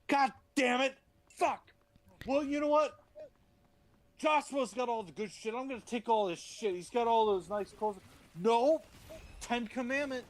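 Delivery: frustrated outburst. Acted. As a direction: blow up like a man who's been told no too often. Moses frustrated outburst, higher pitched.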